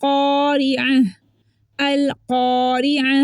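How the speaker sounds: slow, held क़ारिअह with rounded qaf; heavy qaf recitation. Shown as a demonstration. Teacher reciting a Quran example, full-mouthed qaf. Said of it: "कॉफ मोटा है".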